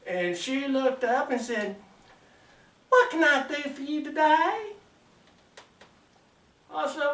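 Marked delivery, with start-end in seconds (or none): 2.9-4.7 higher-pitched Southern belle greeting. Acted. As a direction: greet a customer as a sweet Georgia belle. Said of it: "Ain't nothing like a good-looking Southern belle".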